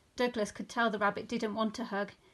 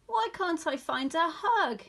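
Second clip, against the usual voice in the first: higher, mildly wailing question; plaintive; 6 semitones higher, 4 dB louder than usual. A narrator's reading. Poor Douglas failing to find a hug, plaintive. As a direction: complain like a disappointed young bear.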